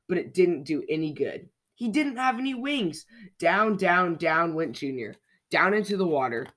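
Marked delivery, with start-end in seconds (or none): none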